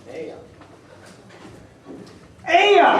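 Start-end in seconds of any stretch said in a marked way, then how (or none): 2.5-3.0 AYA said as two syllables, AY-uh; Maine pronunciation. Shown as a demonstration. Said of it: "are pronounced".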